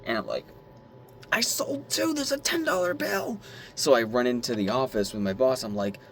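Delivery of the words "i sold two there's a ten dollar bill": very high giddy squeal; excited squeal. Retold or imitated. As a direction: squeal the news with delight. Retold excited announcement.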